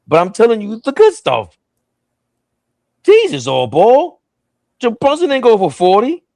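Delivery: high-pitched, incredulous protest; high mock-exasperated comeback; mock indignation.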